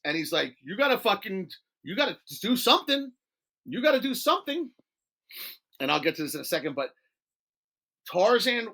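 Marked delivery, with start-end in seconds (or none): 0.6-4.7 higher, insistent push; insistent urging. Retold or imitated. Tony urging his stoned friend to act, insistent. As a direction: urge it on him, pitch climbing with the repeat.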